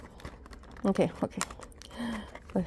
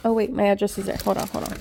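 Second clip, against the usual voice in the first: ordinary delivery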